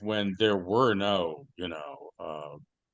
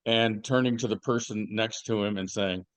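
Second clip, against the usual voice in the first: normal talking voice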